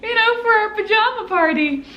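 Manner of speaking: bright high announcement over a crowd; calling out to everyone. Retold or imitated. Retold call to the gathered family.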